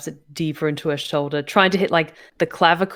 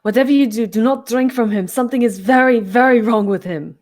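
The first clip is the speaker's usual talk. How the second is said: urgent raised-voice warning, higher and louder; shouted warning; 6 semitones higher, 7 dB louder than usual. Acted. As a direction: call it out loudly as a warning. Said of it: "Um, she shouts out".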